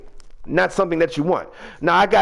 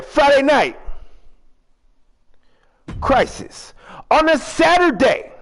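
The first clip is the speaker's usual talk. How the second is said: ring announcer; 6 semitones higher than usual. Pretend. Finishes the show intro.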